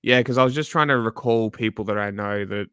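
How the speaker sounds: usual speaking voice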